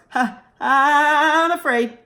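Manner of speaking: slow, small scared voice; a frozen, frightened pencil. Acted. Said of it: "Pencil froze".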